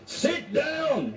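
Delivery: judge's sharp high yell; retold yell. Angry judge yelling at the defendant to sit down. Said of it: "basically yelling".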